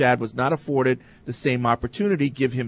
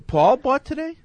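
high, amazed disbelief; surprised; 12 semitones higher than usual. Real, not acted. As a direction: genuinely caught off guard, voice jumping up. Host surprised colleague bought lunch.

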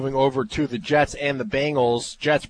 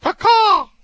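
high loud laughing caw; bird screech caw; 9 dB louder than usual. Real, not acted. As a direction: squawk like a bird. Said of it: "what kind of noise a cardinal makes".

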